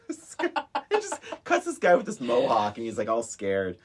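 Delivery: normal talking voice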